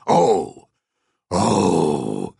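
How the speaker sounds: loud bass growl. A narrator's reading. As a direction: growl it out like a beast. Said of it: "in a loud bass growl".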